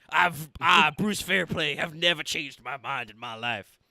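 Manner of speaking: loud, blustering foreman; boastful jury foreman. Acted. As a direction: puff up and boast.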